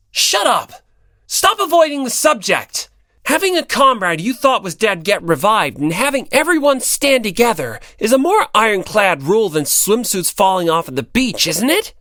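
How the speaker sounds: loud, high indignant tirade; angry shout. Acted. Hinata shouting at Komaeda about revival tropes, loud and heated. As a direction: shout him down and press your point.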